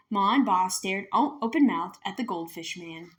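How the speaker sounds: plain reading voice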